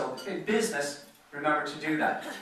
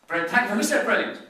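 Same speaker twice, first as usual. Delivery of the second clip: sudden high, loud question; amused surprise; 7 semitones higher, 8 dB louder than usual. Real, not acted. To an audience member who called out, a high, loud amused question. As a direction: pounce playfully on the heckler.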